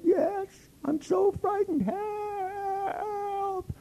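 very high, slow whimpering plea; hammed-up scared falsetto crying; mock fright. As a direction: fake being terrified to get in. Pretending to be frightened.

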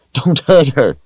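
high, desperate plea; pleading. Acted. Richard on his knees begging Darken Rahl, high desperate plea. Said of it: "pleading up to him".

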